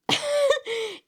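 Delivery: sudden high laughter; real laugh. Real, not acted. Laughs amid the reading.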